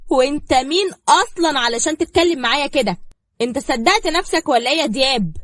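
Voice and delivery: loud, high, cutting rebuke; defiant fury. A narrator's reading. Zahra scolds Diab for sitting in the chair, fierce. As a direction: strike him down with every word. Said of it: "مصدومة من قوة زهرة وطريقتها".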